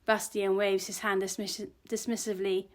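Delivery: plain reading voice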